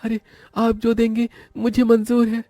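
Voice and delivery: put-on very high-pitched boy's voice, eager to accept; meek, high-voiced boy; poor boy agreeing. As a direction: accept humbly in a high little voice.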